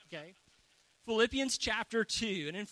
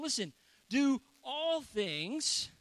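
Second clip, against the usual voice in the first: slow, higher and louder, stress on do; emphatic scripture reading; 4 semitones higher, 4 dB louder, much slower than usual. Acted. Pastor to the church, slow emphatic "do all things". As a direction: lean hard on "do all things", drawn out.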